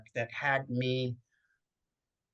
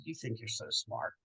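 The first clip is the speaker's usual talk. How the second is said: cold quiet retort; 3 semitones lower, 10 dB quieter than usual. Retold or imitated. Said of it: "And I said".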